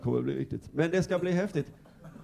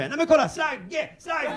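excited calling out; 12 semitones higher, 4 dB louder, slower than usual. Retold or imitated. Excited commentator continues.

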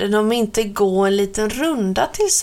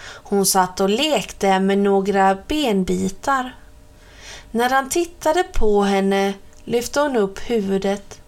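plain reading voice

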